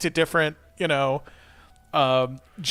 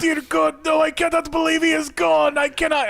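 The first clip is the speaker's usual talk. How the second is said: mock grief; 12 semitones higher, 3 dB louder than usual. Hammed-up mourner kneeling by corpse.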